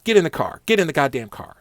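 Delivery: annoyed cop ordering him into the car; cop barking orders. Retold or imitated. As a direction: bark get in the car like an impatient cop.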